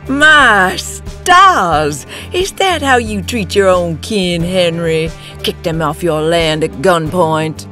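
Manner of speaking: high, scornful exclamation; indignant snort. A narrator's reading. Woman scolding kin over the gun, snorting. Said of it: "put a staying hand on his shoulder and snorted".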